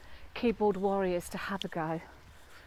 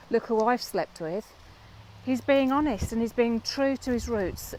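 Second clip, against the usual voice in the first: loud, fervent preaching; passionate sermon; 4 dB louder than usual. Real, not acted. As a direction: preach it with full conviction. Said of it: "So enough the sermon".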